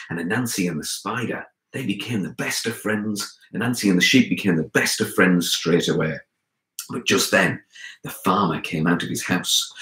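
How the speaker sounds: plain reading voice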